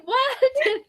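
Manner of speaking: high, loud, laughing "What?"; a bewildered squeak of disbelief; mock confusion. Host acts out not understanding the guest's accent, high and laughing. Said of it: "I was like".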